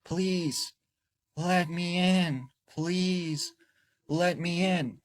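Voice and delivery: put-on high, drawn-out child's plea; eerie young boy. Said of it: "she said this boy responded".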